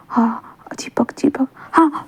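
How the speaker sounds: hissed under the breath, slow; sulky jealous whisper. A narrator's reading. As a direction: whisper it spitefully to yourself. Jealous child mocking whisper.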